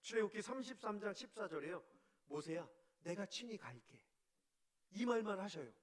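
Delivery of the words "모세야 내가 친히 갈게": quiet, gentle promise of presence; tender divine promise. Acted. God softly promising Moses he will go with him, gentle. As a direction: speak it softly, like a father comforting a scared son.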